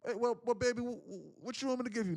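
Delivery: high, sweet motherly voice; playing his grateful mom.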